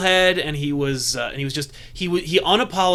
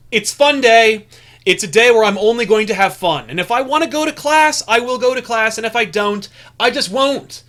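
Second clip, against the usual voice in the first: raised voice, higher and louder; mock proclamation; 5 semitones higher, 5 dB louder than usual. Imitating a classmate in a bathrobe declaring fun day, loud proclamation. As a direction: declare it to the whole school.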